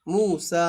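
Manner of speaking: "মূসা" with both vowels drawn out; stretched recitation-style "মূসা"; long-vowel recitation. Shown as a demonstration. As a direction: hold the oo, then the aa in "মূসা". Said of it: "খাড়া জবর হিসেবে পড়ে ফেলবো".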